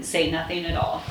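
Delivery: ordinary delivery, nothing marked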